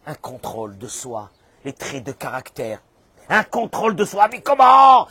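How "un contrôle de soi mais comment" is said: high, loud incredulous outburst; outraged protest. Acted. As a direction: rise to a shouted objection. Acts out protest at self-control. (protestation criée, indignée)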